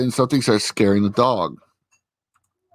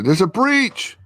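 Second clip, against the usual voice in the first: lightly voiced urgent alert, pitched way up; mock alarm; 12 semitones higher, slower than usual. Agents hear breach warning, high urgent call.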